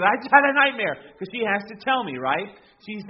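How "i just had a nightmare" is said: put-on high, loud, panicked child; daughter's frantic nightmare cry. Little girl bursting into parents' room, loud and scared. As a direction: burst in crying she had a nightmare. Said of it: "She goes".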